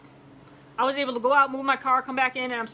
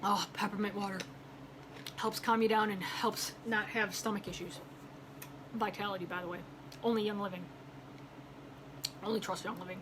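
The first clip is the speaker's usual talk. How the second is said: low, soft, unhurried product aside; quiet aside; 4 semitones lower, 11 dB quieter than usual. Real, not acted. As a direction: drop to a low murmur, as if off to the side. Aside while sipping peppermint water.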